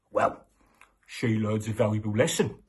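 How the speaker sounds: ordinary delivery, nothing marked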